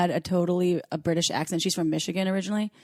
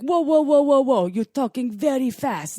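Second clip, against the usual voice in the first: higher, stretched-out whoas; imitated slowing-down plea; 5 semitones higher, 4 dB louder, slower than usual. Ex-husband telling her she talks too fast, slow imitated protest. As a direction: halt a fast talker, dragging each word. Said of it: "my ex-husband would be like".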